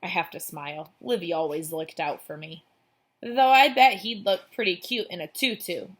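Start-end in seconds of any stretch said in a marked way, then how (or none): 3.2-5.9 louder and higher, meant to carry to the closet; rather loudly. Acted. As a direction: say it loud enough for the eavesdropper to hear. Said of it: "Livy says rather loudly".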